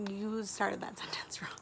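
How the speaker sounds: whispered retort. Retold or imitated. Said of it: "And I was like".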